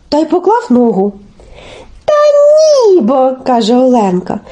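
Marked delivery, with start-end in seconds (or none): 2.1-3.3 high, drawn-out protest; exasperated objection. Acted. Olenka corrects Telesyk on the shovel, high and exasperated. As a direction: wail the refusal from high up.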